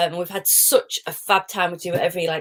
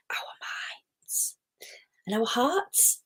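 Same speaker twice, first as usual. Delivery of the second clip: soft whisper; 5 semitones higher, 11 dB quieter, much slower than usual. Real, not acted. Soft reflective point.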